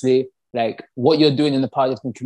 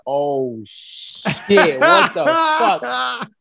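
high, drawn-out stretched disbelief; retold shocked shout; 8 semitones higher, much slower than usual. Retelling his reaction to being reposted, stretched-out shocked cry. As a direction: replay the moment of shock, slowing way down. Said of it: "I literally shouted".

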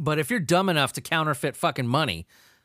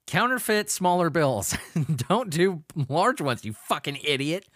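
normal talking voice

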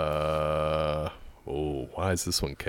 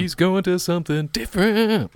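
teasing; 12 semitones higher, 7 dB louder than usual. Acted. Teasing partner's choice aloud.

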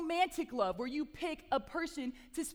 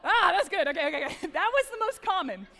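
loud, high, amused outburst; laughing delight; 5 semitones higher, 9 dB louder than usual. Real, not acted. Speaker enjoys the crowd's answer to her song cue, laughing delight. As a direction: react with real delight, breaking into a laugh.

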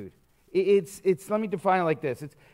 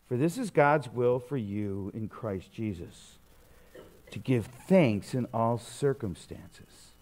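solemn scripture reading; 7 semitones lower than usual. Acted. Reads scripture again, solemn.

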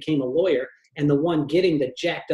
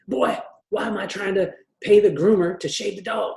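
higher, put-on gruff dad; 5 semitones higher than usual. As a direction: play the stingy dad scolding his son. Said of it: "he had this kind of dad voice".

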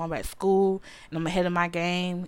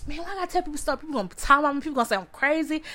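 whiny protest; 7 semitones higher, much faster than usual. Retold or imitated. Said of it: "I'm like".

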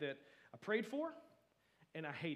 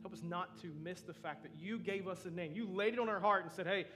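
closing prayer. Acted. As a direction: pray gently for the congregation. Closing prayer over music.

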